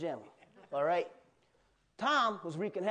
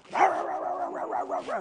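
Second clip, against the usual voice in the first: high, loud barking bulldog noises; snarling dog bark; 11 semitones higher, 4 dB louder than usual. Retold or imitated. Preacher voicing the bulldog in a Tom and Jerry story, high snarling barks. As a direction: bark it out like a furious bulldog on a leash.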